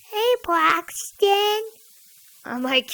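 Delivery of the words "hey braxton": Sierra. Retold or imitated. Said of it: "it's Sierra. She goes".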